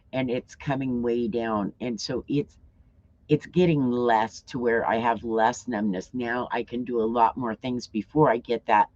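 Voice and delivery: usual speaking voice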